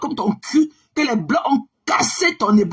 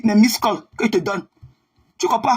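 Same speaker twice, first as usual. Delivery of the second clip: ordinary talk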